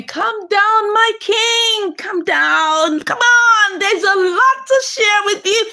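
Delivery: high, drawn-out playful reassurance; excited coaxing. Acted. Wife coaxing her anxious husband with news to share, excited and high.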